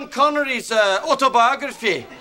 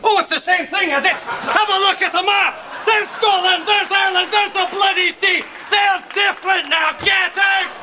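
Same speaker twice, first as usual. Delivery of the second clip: high, outraged rant at a customer; angry shouting; 5 semitones higher than usual. Acted. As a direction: yell them out of the shop. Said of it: "shoot at the customers like that".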